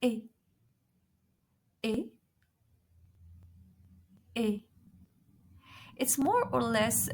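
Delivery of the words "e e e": é said as a tense "ay" sound with no glide; teacher modelling the é sound for students; model French é. Shown as a demonstration. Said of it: "listen carefully".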